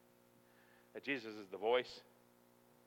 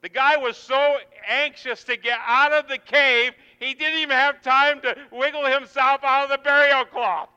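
high, very loud exultant shouting; full-force preaching; 22 dB louder than usual. Acted. Preacher telling of Lazarus escaping the cave, full-force shouting. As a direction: pour it out at the top of your voice.

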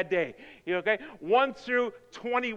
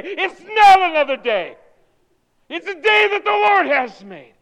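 loud, high preaching; emphatic correction; 8 semitones higher, 11 dB louder than usual. Real, not acted. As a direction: proclaim it forcefully against indifference. Refuting just another day.

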